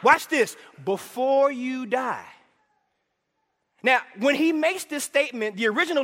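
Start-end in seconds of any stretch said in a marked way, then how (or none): 0.8-2.3 slow, weighty delivery; slow punchline. Acted. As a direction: drop the line slowly for effect.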